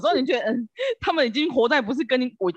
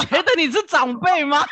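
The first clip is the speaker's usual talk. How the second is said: laughing; 7 semitones higher, 3 dB louder than usual. Real, not acted. Teasing about being elders.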